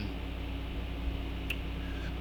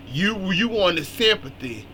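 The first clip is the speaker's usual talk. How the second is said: usual speaking voice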